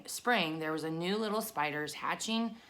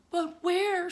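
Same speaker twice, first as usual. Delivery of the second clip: much higher, small and eager question; tiny spider voice; 13 semitones higher, 6 dB louder than usual. A narrator's reading. Spider asks, voiced high.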